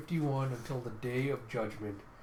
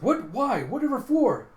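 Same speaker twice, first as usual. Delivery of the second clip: high, loud, incredulous squawk; hammed-up baffled protest; acted bewilderment; 11 semitones higher, 10 dB louder than usual. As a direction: blurt out startled questions. Character baffled at being told hit me.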